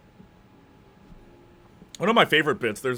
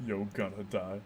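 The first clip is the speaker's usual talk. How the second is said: tag imitation; 4 semitones lower, 9 dB quieter than usual. Echoes menacing line of played clip.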